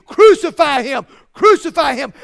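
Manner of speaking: loud preacher's mock crowd yell; screaming mob, imitated. Preacher voicing the crowd at the cross, loud imitated scream. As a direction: hurl it out like a jeering crowd, punched hard. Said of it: "and they screamed".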